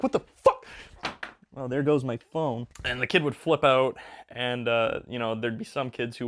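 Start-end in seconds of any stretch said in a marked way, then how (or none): 0.0-0.7 high, loud panicked yelp; mock-terrified shriek; freaked-out kid. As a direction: flip out like a scared child. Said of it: "the kid would flip out and be like".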